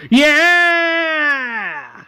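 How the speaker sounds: long, high loud cheer; cheering crowd. Acted. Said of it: "As the people cheer".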